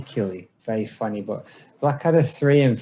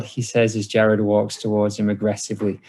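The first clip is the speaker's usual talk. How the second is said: ordinary delivery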